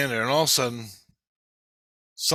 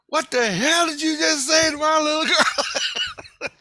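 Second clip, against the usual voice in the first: high, loud, slow mock screech; hammed-up furious mom, laughing through it; outraged mother impression; 6 dB louder, slower than usual. Acting out the angry mother's confrontation.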